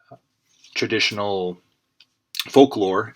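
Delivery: light put-on Irish accent; a lightly put-on Irish lilt. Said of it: "I'm speaking in an Irish accent".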